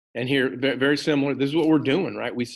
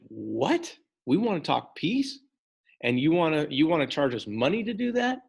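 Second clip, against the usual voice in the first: higher-pitched, incredulous questioning; mock indignant disbelief; 5 semitones higher than usual. American envoys answering a French bribe demand, mock indignant disbelief. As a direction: play at being affronted, voice lifting into each question.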